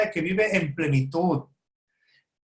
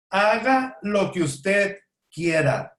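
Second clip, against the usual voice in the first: slow punchline; much slower than usual. Acted. Punchline of the principle, delivered slowly.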